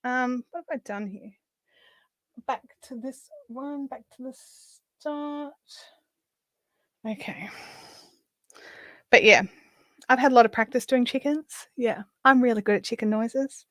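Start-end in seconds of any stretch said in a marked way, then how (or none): 0.1-7.5 muttering. Real, not acted. Muttering to self while crafting.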